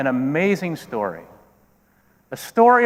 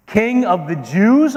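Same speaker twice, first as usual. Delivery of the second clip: slow, rising question, higher pitched; outraged disbelief; 6 semitones higher, 3 dB louder, slower than usual. Acted. Herod reacting to news of a rival king, incredulous. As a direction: echo it back in affronted disbelief.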